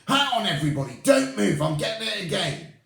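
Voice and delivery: very high, loud alarm; alarmed witch catching a scent; excited witch. Acted. Mildred alarmed, sniffing.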